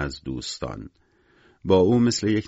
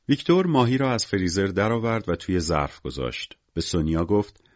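plain reading voice

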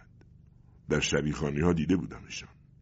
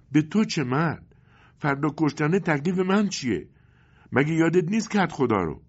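tearful; 11 semitones higher, 5 dB louder than usual. Acted. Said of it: "اشک در چشمای مادر حلقه بست".

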